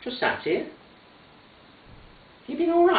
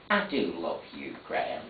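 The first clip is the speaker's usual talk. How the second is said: soft, low, tender; 12 semitones lower, 12 dB quieter than usual. Acted. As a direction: say it gently to her son. Tender mother's words.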